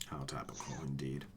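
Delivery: dry sarcastic whisper, breathy and hushed; sarcastic whispered echo of the co-host; mock whisper. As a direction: hush it like a conspiratorial aside. Mocking echo of co-host's quip.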